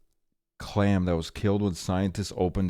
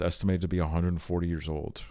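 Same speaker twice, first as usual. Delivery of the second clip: ordinary talk, nothing marked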